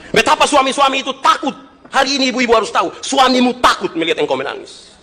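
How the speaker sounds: high-pitched, emphatic preacher; preaching at full force. Acted. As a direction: drive it home to the congregation.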